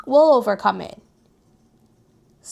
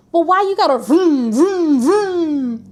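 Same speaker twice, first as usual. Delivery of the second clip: engine noise imitation; 4 semitones higher, 4 dB louder, slower than usual. Mocking loud cars.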